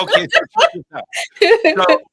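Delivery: high, fast eager setup; host can't wait to tell the next part, fast and high; excited. Real, not acted. Eager lead into story.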